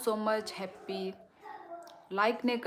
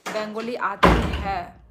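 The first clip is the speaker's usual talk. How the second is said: loud question; 11 dB louder, slower than usual. Real, not acted. Reading a viewer question loudly.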